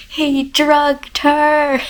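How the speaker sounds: slow, high, loud revelation; stunned realization. Real, not acted. Telling viewers the plot twist, loud slow shock. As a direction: it all clicks at once.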